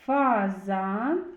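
"Фазан" with the first "а" held long; sounding out A. Shown as a demonstration. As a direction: stretch the first vowel of "Фазан". («Фазан» з протяжним першим «а»)

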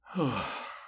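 soft high sigh of relief; relieved sigh. Acted. Dragon resting after the arrow is gone, relieved sigh. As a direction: breathe out the pain.

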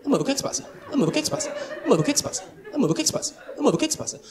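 high whiny repeated amor o que é que se passa; nagging girlfriend. Retold or imitated. Girlfriend pestering him in the car, high whiny nag.

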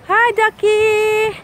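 cooing to a duck. Real, not acted. Greeting a duck, slowed.

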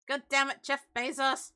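hammed-up curse aimed at Bezos; mock outrage. Annoyed streamer hammily cursing Jeff Bezos, mock outrage. Complaint about ads.

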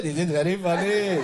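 lightly put-on Russian accent on English; a hint of a Russian speaking English; imitating a Russian accent. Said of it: "E, Ruslarınki öyle". (hafif Rus aksanı taklidi)

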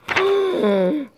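a high hammed-up gasp of alarm; mock gasp. A narrator's reading. Reading to a child, a playful horrified gasp.

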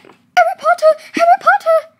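high, loud squeal of delight; excited shout. Real, not acted. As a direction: shriek the name with joy. Thrilled at seeing the shirt.

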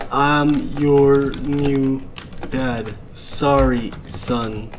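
very low, slow delivery; halting low put-on voice; slow character voice. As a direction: draw out each phrase deep and slow. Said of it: "This is how he talks".

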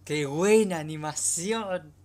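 slow, loud, high, amazed; awed. Real, not acted. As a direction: savor every word in awe. Awed by animation.

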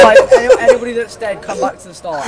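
high laughing call-out that fades to breathy giggles. Real, not acted. Calling dead players back to the start, laughing. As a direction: shout the order through laughter.

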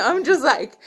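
half laugh, half cry, real; overwhelmed, laughing and choking up; laughing through tears. Real, not acted. Overcome mid-testimony, laughing-crying.

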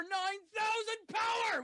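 high, shouted boast; excited hype-man yell; hyped announcer shout. Acted. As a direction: punch it up like a ring announcer. Hyped introduction of the guest.

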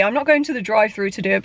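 normal talking voice

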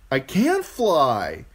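high, loud, slow wail; distressed boy. A narrator's reading. Bran protesting as he plunges, distressed wail. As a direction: cry out helplessly, dragging the words.

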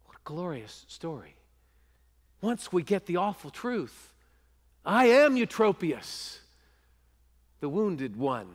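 usual speaking voice

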